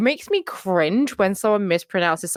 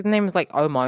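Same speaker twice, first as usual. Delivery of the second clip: ordinary talk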